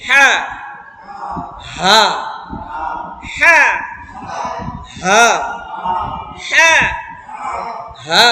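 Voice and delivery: each হা pushed hard from the throat; drilled throaty হা. Shown as a demonstration. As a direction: repeat the pressed হা. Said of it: "এই হাটা হবে".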